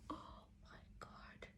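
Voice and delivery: slow, hushed retold amazement; stunned whisper. Woman just asked to marry, whispering in retold amazement. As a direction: breathe it out slowly in a whisper.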